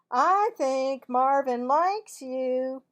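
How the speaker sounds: loud, slow taunt; teasing girl. Acted. Melanie to Cassie, loud slow tease. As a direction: say it for him to hear.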